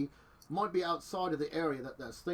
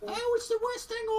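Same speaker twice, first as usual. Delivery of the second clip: high, squeaky Cockney voice; put-on Cockney accent; 4 dB louder than usual. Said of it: "she's Cockney".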